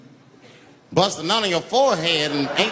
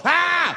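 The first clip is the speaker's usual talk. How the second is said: high, short action-movie cry; acted yell; 12 semitones higher, 3 dB louder than usual. Homeless man shooting at the charging passenger, high yell.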